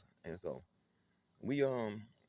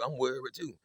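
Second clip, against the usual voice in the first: ordinary delivery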